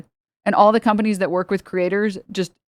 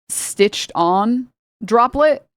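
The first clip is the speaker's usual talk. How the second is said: drawn-out emphasis; 4 semitones higher, much slower than usual. Real, not acted. Stressing the absurd detail.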